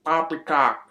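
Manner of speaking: lightly put-on posh British accent on poppycock; hammed-up British policeman accent; mock British accent. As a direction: imitate a bad fake British accent. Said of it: "puts on the greatest British accent ever".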